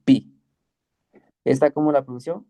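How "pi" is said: "pi" said with a clean p and a short ee; Greek letter pi. Shown as a demonstration. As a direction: clip "pi" short and crisp. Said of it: "P es igual a, así".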